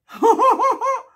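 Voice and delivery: bright squealing laugh; relieved high laugh. Real, not acted. Relieved after earring goes in.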